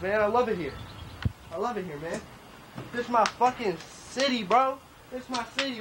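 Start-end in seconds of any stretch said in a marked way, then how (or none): none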